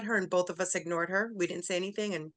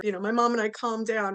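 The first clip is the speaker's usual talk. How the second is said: ordinary talk, nothing marked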